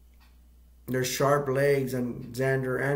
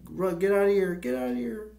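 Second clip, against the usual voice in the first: high-pitched imitated plea to flee; mock urgent cry; 8 semitones higher than usual. Injured Watkins to Rico, high mock urgent cry. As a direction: voice climbs higher as if yelling to friends.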